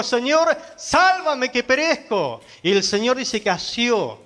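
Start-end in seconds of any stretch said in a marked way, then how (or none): 0.0-2.4 acted cry for rescue, high and drawn out; preacher imitating a desperate plea, pitch jumping up. Pedro sinking, crying to the Lord, acted desperate cry. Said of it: "en su desesperación clamó al Señor".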